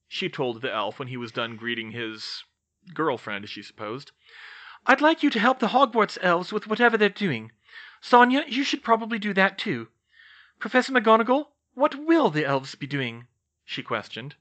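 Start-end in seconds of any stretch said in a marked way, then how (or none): 4.8-13.3 high-pitched, brisk organiser; pitched-up, purposeful request; businesslike young witch. A narrator's reading. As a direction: give polite instructions in a higher voice. Narrator voices Hermione.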